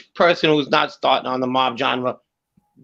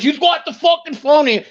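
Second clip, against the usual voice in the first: raised, higher-pitched retold outburst; animated mock confrontation; mock aggressive stranger; 11 semitones higher than usual. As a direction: act out the angry guy at the payphone, pitched up. Acting out man ripping phone off.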